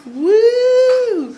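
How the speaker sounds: high, loud whoop; real joyful whoop; cheer. Real, not acted. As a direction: celebrate the win. Celebrating weight loss.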